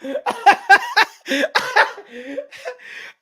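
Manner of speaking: long, high cackle; helpless high-pitched laughter; laughing. Real, not acted. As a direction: burst out laughing at your own bit. Cracks up after his impression.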